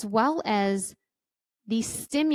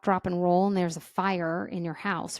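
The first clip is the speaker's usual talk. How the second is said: ordinary talk, nothing marked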